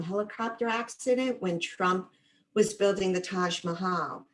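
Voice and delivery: usual speaking voice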